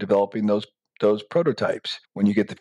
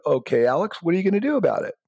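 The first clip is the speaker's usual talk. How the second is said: much higher, mocking challenge; smug taunt; 8 semitones higher, faster than usual. Copier daring the inventor to act, mocking and high. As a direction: dare him to act, smugly.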